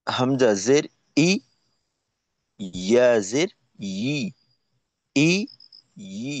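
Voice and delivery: ই with a hard glottal catch, then ই soft; hard against soft. Shown as a demonstration. Teacher contrasting hamza and ya, hard against soft. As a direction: start ই hard from the throat, then softly. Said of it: "একটা শক্ত উচ্চারণ".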